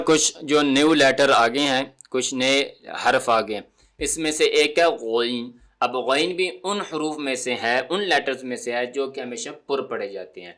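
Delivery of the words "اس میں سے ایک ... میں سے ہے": ordinary talk, nothing marked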